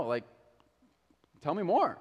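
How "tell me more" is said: curious. Acted. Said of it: "Or she's curious, and she wants to know".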